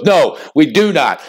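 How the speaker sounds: normal talking voice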